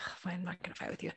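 fast, hushed giving-in; resigned whisper. Retold or imitated. Mother gives up arguing with daughter, whispered. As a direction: breathe it out quickly under your voice.

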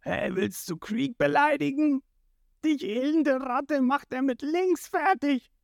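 high, strained angry comeback; indignant retort. A narrator's reading. Jin defending Krieg's honour, high indignant retort. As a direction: snap back in defence of your boss.

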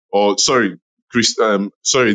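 ordinary talk

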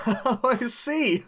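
words squeezed out through laughter; laughing. Real, not acted. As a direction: say it while cracking up. Host cracking up at question.

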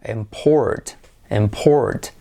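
imPORT, IM kept low; stress on second syllable. Shown as a demonstration. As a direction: lean on PORT in import. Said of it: "precedida de O". (import con acento en la segunda sílaba)

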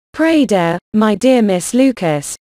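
plain reading voice

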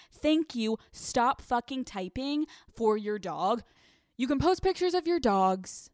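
normal talking voice

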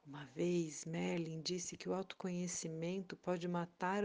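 ordinary delivery, nothing marked

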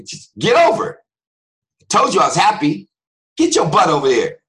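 loud, high mock-angry yelling; hammed-up exasperated parent shout; scolding parent. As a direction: snap at a child who won't listen. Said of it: "talking to kids like that".

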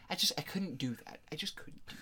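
near-whispered, trailing off; hushed admission. Real, not acted. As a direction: drop to a soft whisper, as if confessing. Admits reluctance to complain.

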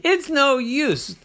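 high, loud, slow whine; drawn-out whiny despair; despairing kid. A narrator's reading. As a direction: wail it hopelessly. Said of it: "And Oren said".

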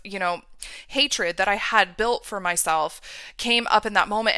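ordinary delivery

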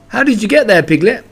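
lightly voiced boy calling out, surprised; Christopher Robin. A narrator's reading. Boy finds Piglet in the pit, high and ordinary. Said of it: "in his ordinary voice".

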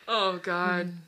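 usual speaking voice

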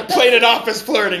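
sheepish laughing explanation; explaining the panic, laughing sheepishly. Retold or imitated. Mock excuse, laughing.

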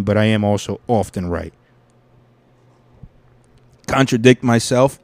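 ordinary talk, nothing marked

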